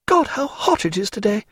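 high woman's exclamation; flustered Diana. Acted. Diana flicking her fan, flustered high voice. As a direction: exclaim in a high voice to change subject. Diana nervously changes the subject.

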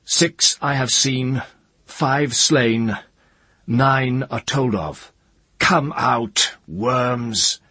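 loud, high challenge to hidden foes; high, measured summons at full voice; calling out. A narrator's reading. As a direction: call them out boldly, slow and ringing. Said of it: "and then he called".

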